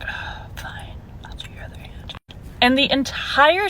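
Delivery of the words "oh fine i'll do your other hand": whispered, grudging mock reply. Nail tech grudgingly agreeing to finish, mock whisper. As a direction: whisper it like a sulky giving-in.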